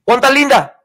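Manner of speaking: high, loud sing-song question; peekaboo call. Pretend. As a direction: ask like playing peekaboo with a child. Peekaboo game with Linda.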